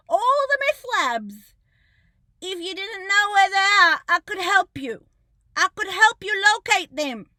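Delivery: loud sarcastic jab at the police; sarcastic offer. Real, not acted. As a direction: taunt the police with a fake helpful offer.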